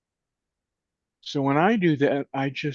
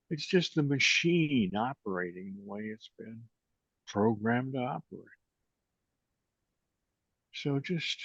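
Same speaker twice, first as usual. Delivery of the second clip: guided meditation; 4 dB quieter than usual. Acted. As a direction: guide them gently into the breath. Leads a closing meditation.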